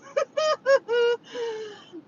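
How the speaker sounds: high-pitched giggling laugh; laughter. Real, not acted. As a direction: burst into a high, drawn-out laugh. Laughing at own guessing.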